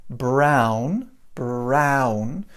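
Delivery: "brown" slow, with a full ow glide; slow drill. Shown as a demonstration. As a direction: drill "brown" slowly for students. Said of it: "we practiced this".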